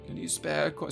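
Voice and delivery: high whiny beggar plea; pleading beggar. Retold or imitated. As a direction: beg for a coin.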